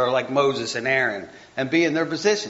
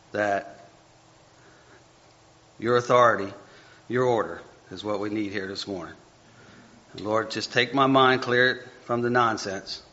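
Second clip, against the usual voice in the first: soft, lower earnest prayer; 4 semitones lower, 5 dB quieter than usual. Real, not acted. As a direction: pray quietly to the Lord for help. Prays mid-sermon.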